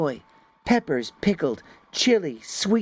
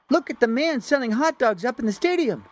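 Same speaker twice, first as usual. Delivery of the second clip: shouting; 10 semitones higher, faster than usual. Acted. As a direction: yell it to grab his attention. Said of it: "Snowflake is shouting something".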